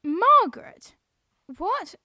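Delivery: Margaret? blurted out high and loud; shocked by the name, almost shouting; loud surprised disbelief. Acted. Girl surprised by full name.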